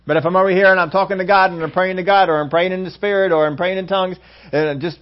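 ordinary talk

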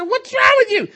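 high, loud bewildered shout; retold exasperated yell. Preacher retelling his reaction to her fit, exasperated shout. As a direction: yell it over her tantrum, hammed up.

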